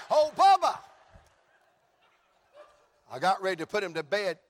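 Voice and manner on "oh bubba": high, loud whoop of the name; exuberant holler. Acted. Preacher whooping his son Bubba's name, exuberant holler.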